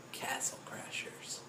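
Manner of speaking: slow breathy whisper; hushed reveal. Real, not acted. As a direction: whisper the title dramatically. Hushed title in the final list.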